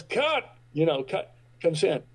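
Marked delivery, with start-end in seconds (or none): 0.0-0.4 sharp, much higher cry of cut; director's cut call. Retold or imitated. Director stopping the scene, high sharp cut. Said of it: "David Milch goes".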